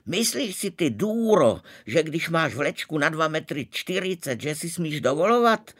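very high, loud scolding; shouting. A narrator's reading. Eva screaming at the princess while hair-pulling. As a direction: scream it at her rival. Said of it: "Eva křičela".

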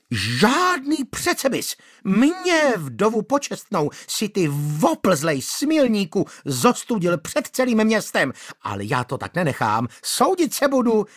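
high, shrill, outraged scolding; shrill angry widow. Acted. Manda scolding the magistrate shrilly. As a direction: play the wronged widow screeching.

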